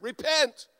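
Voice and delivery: high, urgent prophet's cry; preacher's call to repent. Retold or imitated. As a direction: call out loudly like a fiery prophet. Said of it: "He called people to repent".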